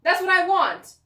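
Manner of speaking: high, loud, emphatic declaration; loud heated demand. Real, not acted. Vlogger ending her rant about K-pop videos, loud and insistent. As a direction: hammer home the demand at full volume.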